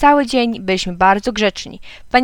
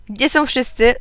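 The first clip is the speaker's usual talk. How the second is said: ordinary delivery, nothing marked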